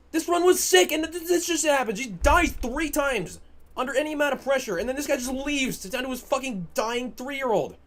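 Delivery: angry rant. Real, not acted. Streamer venting at the teammate who left, angry rant. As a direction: vent it loud and high.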